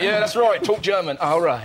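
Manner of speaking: imitating a man loving the attention; mock gloating relish. Comic imagines Mosley jostled outside court, mock relish. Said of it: "I bet he bloody loved it".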